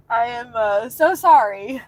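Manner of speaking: tearful apology; crying. Real, not acted. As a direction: voice wet with tears, apologizing. Upset streamer apologizing, crying heard.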